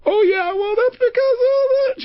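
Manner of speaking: very high, drawn-out tearful wail; mock crying. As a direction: imitate her dissolving into tears mid-sentence. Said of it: "She started crying again".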